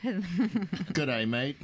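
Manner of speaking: attempted Kiwi greeting that comes out Australian; bad New Zealand accent. Shown as a demonstration. Host greets New Zealand winemaker in a badly faked Kiwi accent. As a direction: have a clumsy go at an Antipodean accent. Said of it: "tell him not to do accents".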